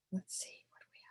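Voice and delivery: whispering. Pretend. Revealing puppet to children.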